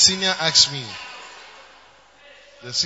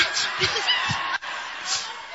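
burst of laughter. Real, not acted. Laughing at own story.

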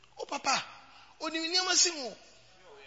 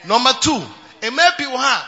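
louder, shouted preaching; preaching at full force; 2 semitones higher, 11 dB louder than usual. Acted. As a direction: drive the point home to the crowd.

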